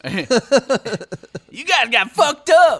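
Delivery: high shouted drunk-buddy impression; loud, hammed-up high-pitched yell; Jeff Beach. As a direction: holler it slowly, way up high. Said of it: "that's how he sounds".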